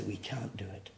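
breathy hush of humble admission; whisper. Retold or imitated. Church admitting to the congregation it cannot cure, whispered. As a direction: whisper it as a confession. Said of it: "we stand back and say".

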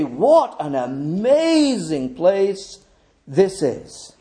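slow, drawn-out wonder; awed. Acted. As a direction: stand back and marvel slowly. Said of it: "stand back in awe and you say".

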